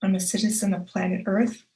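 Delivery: ordinary talk, nothing marked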